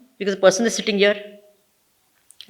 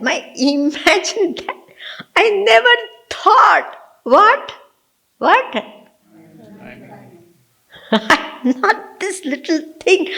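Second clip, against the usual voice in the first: slow, higher, awestruck; ecstatic wonder; 6 semitones higher, slower than usual. Shown as a demonstration. Teacher shows students how to be fascinated, slow and awestruck. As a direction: marvel at it as if for the first time. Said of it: "get ecstatic and fascinated?".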